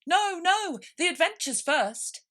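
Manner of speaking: high, loud impatient Gryphon; loud and brusque Gryphon. Acted. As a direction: brush them off, hurry up. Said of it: "in an impatient tone".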